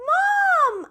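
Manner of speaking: high, thrilled call to mom; excited yell. A narrator's reading. Child calling mother to see the tadpoles, excited high call. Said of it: "I yelled with excitement".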